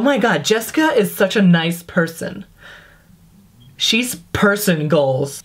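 mock internet talker. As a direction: drag it out like a vapid poster. Mocking internet-speak.

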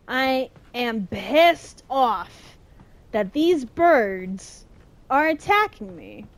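very slow emphatic words; slow deliberate complaint. Real, not acted. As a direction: spell out annoyance slowly. Angry at birds.

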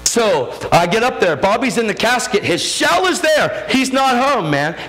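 high-pitched, anguished testimony; urgent preacher reliving a painful moment; impassioned preaching. Real, not acted. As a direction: cry it out to the congregation, line upon line. Preaches the funeral at full pitch.

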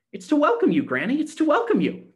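high, loud joyful cry; excited cry. A narrator's reading. Gretchen cries out to welcome Granny home, high and loud. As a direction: call out with delight. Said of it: "cried Gretchen".

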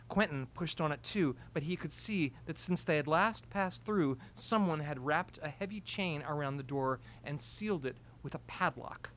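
usual speaking voice

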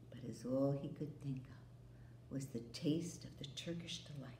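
hushed, unvoiced whisper. A narrator's reading. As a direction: breathe it like a secret craving. Craving whisper.

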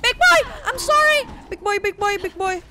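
very high loud pleading cry; half-joking terror; mock panicked shriek. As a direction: shriek an apology in fake panic. Shrieking apology to monster.

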